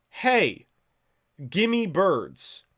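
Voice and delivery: loud, high mock demand; pestering call-out. Acting out his pestering messages.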